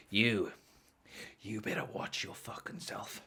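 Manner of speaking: low, hushed threat; menacing laborer. Retold or imitated. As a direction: imitate a tough guy threatening under his breath. Acts out laborer threatening him.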